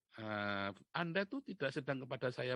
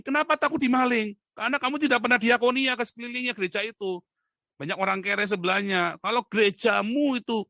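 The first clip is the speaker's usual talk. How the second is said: loud, high interrogating rant; angry challenge; 5 semitones higher, 11 dB louder than usual. Real, not acted. Preacher challenging a pastor on his locked church, shouting. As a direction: fire accusing questions at full volume.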